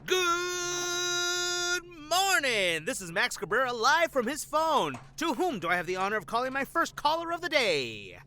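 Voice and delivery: mock radio announcer opening the call-in show; teleprompter host voice. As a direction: sell it like a cheesy morning DJ. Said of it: "not acting like a teleprompter".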